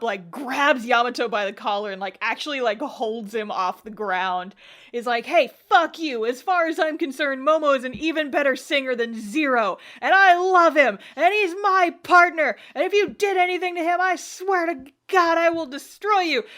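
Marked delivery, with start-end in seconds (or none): 5.3-16.4 high, loud hammed-up outrage; exaggerated furious defence, building to a threat; super worked up. Yuki defending his partner to Yamato, mock worked up. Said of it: "He gets super worked up".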